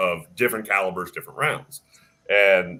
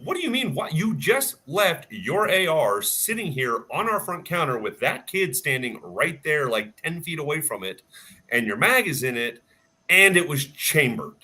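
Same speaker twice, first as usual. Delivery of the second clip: incredulous scolding; 6 semitones higher than usual. Retold or imitated. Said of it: "And I'm like".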